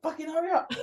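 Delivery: high, hurried mock urging; a hint of panic, hissed under the breath; urgent hurry up. Urging a hiker to hurry on the summit, lightly voiced panic. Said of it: "I'm like".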